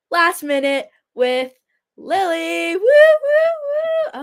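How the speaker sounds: drawn-out announcer intro rising into high whoops; segment announcer. Pretend. Host introducing Last Minute with Lily, excited announcer fanfare. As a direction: hype your own segment, climbing to loud high woos.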